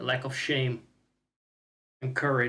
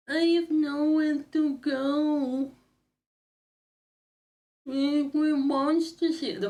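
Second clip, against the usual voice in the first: very high, slow, whimpering voice; trembling little creature, afraid to go out; scared character voice; 12 semitones higher, 4 dB louder, slower than usual. Acted. Voices a fearful game character.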